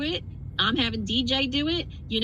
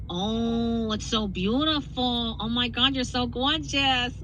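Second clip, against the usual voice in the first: slow, drawn-out Jersey accent, lightly done; slower than usual. Shown as a demonstration. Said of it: "The Jersey accent comes out".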